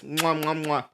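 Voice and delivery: smacking kiss sounds to chat; blowing kisses. Real, not acted.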